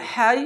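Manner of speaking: "হাই" with air released on the হা; airy ha. Shown as a demonstration. Teacher showing the letter ha, "হাই" on a rush of air. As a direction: push breath through the start of "হাই". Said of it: "এই হা উচ্চারণটি বাতাস বের হবে".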